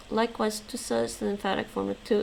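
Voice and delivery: ordinary talk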